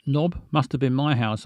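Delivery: ordinary talk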